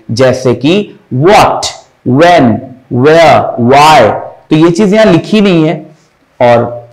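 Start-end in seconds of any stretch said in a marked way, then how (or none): 1.1-4.0 what, when, where, why with rounded lips; rounded W sound. Shown as a demonstration. Teacher demonstrating the W symbol, rounded start on what, when, where, why. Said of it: "mouth को गोल हो जाना चाहिए".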